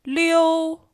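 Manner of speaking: Liu held high and level; first tone. Shown as a demonstration. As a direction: keep Liu flat and high. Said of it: "Here are the four tones".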